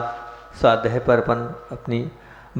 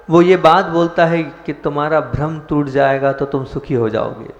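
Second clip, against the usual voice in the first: ordinary talk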